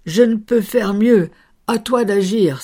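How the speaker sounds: the saint calls out to God; crying out to heaven. A narrator's reading. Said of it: "en criant droit au ciel".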